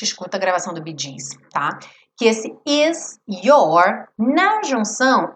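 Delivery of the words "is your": "is your" slow, with the s not sounding like z; linked is your. Shown as a demonstration. Teacher isolates the linking for students. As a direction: stretch "is your" slowly, s joined onto your. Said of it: "esse S não tem aquele som de Z".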